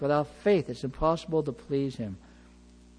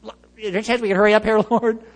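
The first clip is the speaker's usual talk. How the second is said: fast, rushed plea; impatient father rushing words; anxious hurry; 4 semitones higher, 6 dB louder than usual. Retold or imitated. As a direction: hurry the Lord along. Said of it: "he's kind of like".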